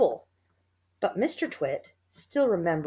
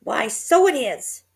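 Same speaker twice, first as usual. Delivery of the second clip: put-on higher, louder, slow old woman; higher, louder, slow old wife; Missus Twit voice; 6 semitones higher, 7 dB louder, slower than usual. A narrator's reading. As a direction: voice her startled and slow. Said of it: "Missus Twit said".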